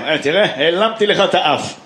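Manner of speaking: lightly voiced old uncle joking; corny uncle. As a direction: play the cheesy uncle trick. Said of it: "אה, דוד כזה".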